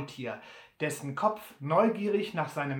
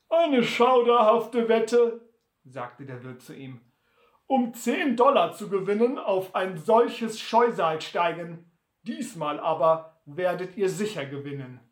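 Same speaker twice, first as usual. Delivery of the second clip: lauter, höherer Wirt, langsam; abfällig über das Maultier reden; Wirt spöttisch; 6 dB louder than usual. Acted. Der Wirt spricht. (der Wirt spricht laut und abfällig zu Butler)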